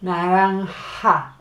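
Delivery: Naranja said slowly with a ha on the last syllable; slow Naranja, falling at the end; slow model. Shown as a demonstration. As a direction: stretch out Naranja so the ha shows. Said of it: "makes a ha".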